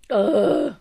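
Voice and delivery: loud groan to shake off tears; exasperated groan. Real, not acted. Singer pulls herself together after crying, exasperated groan. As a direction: let out a frustrated ugh.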